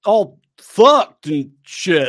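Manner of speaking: loud, high, drawn-out SoCal dude; Southern California accent. Acted. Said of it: "Southern California".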